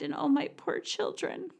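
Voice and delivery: high, soft, mocking put-upon voice; a lightly hammed-up pitiful mother; mock whiny mother. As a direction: parody the martyr mom sighing over her kids. Mocking a guilty mother's lament.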